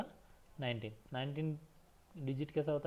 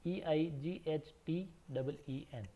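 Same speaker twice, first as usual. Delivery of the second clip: slow spelling; much slower than usual. Shown as a demonstration. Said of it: "eighteen की spelling है".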